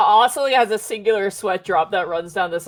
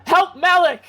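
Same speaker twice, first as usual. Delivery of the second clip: loud, higher shout for help; calling out; 8 semitones higher, 6 dB louder than usual. Acted. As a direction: call across the battlefield. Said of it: "he'll turn to Arl and go".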